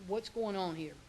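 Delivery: ordinary delivery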